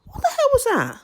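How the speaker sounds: high, incredulous inner voice; shocked disbelief. Retold or imitated. Recalling her reaction to the boyfriend, high shocked disbelief. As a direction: replay your shock at the boyfriend. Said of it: "And I thought".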